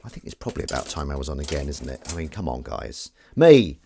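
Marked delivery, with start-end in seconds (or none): 3.3-3.8 eager call. Real, not acted.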